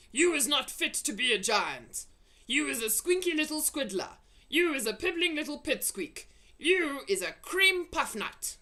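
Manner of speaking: loud, high mocking insults; scornful giant. A narrator's reading. Blood bottler taunting the BFG before leaving, scornful. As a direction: pile on the scorn.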